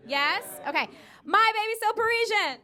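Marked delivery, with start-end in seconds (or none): 1.2-2.6 loud, high setup line to the crowd; raised, projected hype call; call-and-response shout. Shown as a demonstration. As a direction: call it out for the audience to answer. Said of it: "I'm gonna say".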